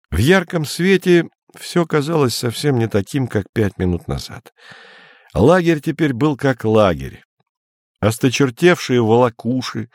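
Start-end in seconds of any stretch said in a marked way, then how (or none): none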